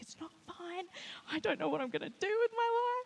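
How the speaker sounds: high-pitched tearful wail, played up; mock crying. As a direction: wail it like a hammed-up meltdown. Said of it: "and I started crying".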